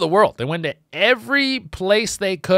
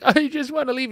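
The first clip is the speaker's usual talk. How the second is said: much higher, pleading voice; high pleading captive; 11 semitones higher than usual. Retold or imitated. Kidnapped contestant begging to leave, high pleading. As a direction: whine to be let go. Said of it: "And they're like".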